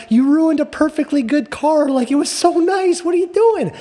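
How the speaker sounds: mock tearful wail, pitched very high; crying mom. Mom scolding son for ruining a good car, mock crying. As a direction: imitate a distraught mother scolding in a high whine. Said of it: "she literally started crying".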